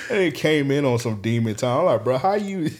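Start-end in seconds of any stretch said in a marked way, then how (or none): 2.0-2.7 high, laughing "how you"; mock-baffled question, cracking up; laughing disbelief. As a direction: ask it through a laugh, incredulous.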